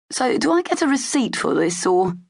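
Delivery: high, wry mock-innocent question; cheeky. A narrator's reading. Cale joking to the Imperial guards, cheeky and wry. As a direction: needle the guards with a joke.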